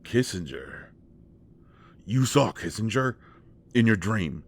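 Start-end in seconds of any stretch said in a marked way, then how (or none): 2.0-4.4 startled, louder and higher, disbelieving; a sudden jolt of disbelief; surprised. Acted. To Bats about the dream, startled disbelief.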